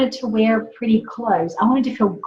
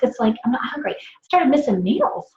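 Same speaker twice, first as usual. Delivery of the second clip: usual speaking voice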